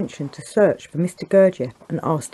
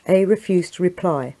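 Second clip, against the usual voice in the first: plain reading voice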